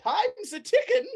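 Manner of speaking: high, loud, lively preacher; hurrying Brother Flufferkin. Acted. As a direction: chivvy them along brightly. Preacher figure hurries the party.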